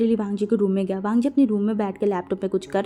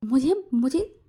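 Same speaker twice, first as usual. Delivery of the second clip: ordinary delivery, nothing marked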